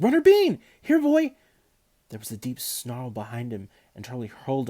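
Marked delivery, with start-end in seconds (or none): 0.0-1.3 much higher, much louder calls for the dog; shouted. A narrator's reading. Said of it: "he shouted".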